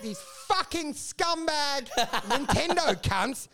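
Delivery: slow, high-pitched comic fury; mock outrage. Acting out his switch turning against the Japanese, mock outrage. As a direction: draw out the swearing in put-on disgust.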